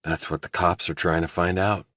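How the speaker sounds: plain reading voice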